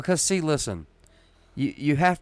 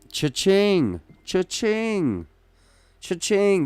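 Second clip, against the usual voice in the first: mock cash register; 9 semitones higher, much slower than usual. Mocking money changing hands.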